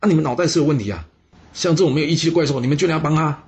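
high, loud, fast furious scolding; very angry. A narrator's reading. General Yi scolds captives for helping a monster, furious scolding. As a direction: scold them in a rush of fury. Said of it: "一听到这非常生气啊".

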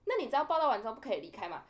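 loud rebuking question; imitating a fierce officer; harsh scolding. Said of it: "他就这么凶哦".

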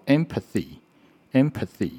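"empathy" with a careful th before the final ee; "empathy" with tongue between the teeth on th; model TH word. Shown as a demonstration. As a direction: sound out "empathy" twice for learners. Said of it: "这个字叫做".

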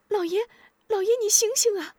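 high, fast pleading call; calling to wake him. A narrator's reading. Maid calls the fainted master, high and frantic. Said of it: "一边呼喊".

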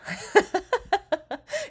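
high rising laugh. Real, not acted. Laughing after telling the story. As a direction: let a laugh burst out.

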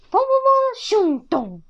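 high, loud rapid bangs, then drop; cartoon burrow-and-thud sound; imitated impact noises. A narrator's reading. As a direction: voice the tumble with gusto. Sound effects of hedgehog burrowing down.

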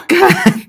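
sudden loud laugh; a big real laugh at her own story; burst of laughter. Real, not acted. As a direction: crack up loudly.

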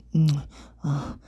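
short moans; acted moaning. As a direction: let out brief moans. Erotic story, acted moans. (轻短呻吟)

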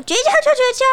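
put-on high huffy kid voice snapping back; 赌气回嘴. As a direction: toss it back in a sulk. 吵架绝交的孩子. (小黑赌气回嘴)

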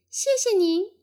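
high, loud little tadpole thanks. A narrator's reading. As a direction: pipe up politely as a baby tadpole. Tadpoles thank big fish. (小蝌蚪高声道谢)

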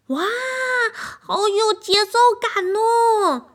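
high, slow, loud wow; the orchestra amazed by the drum, high and loud; amazed delight. A narrator's reading. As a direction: marvel at the rhythm.

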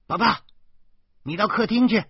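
high, loud call from the kitchen; calling loudly. A narrator's reading. Mother calling her child from the kitchen, high and loud. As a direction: call out to the child from another room. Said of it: "老婆在厨房里大声说".

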